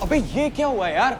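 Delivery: loud, sudden what-happened shout; jolted out of the show; startled outburst. Real, not acted. Power cuts during a tense show.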